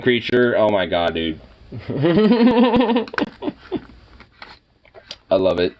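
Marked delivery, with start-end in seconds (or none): 1.7-3.8 rising, high-pitched cackle; laughing. Real, not acted. Cracking up at some demon creature, rising cackle. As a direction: laugh climbing into a squeal.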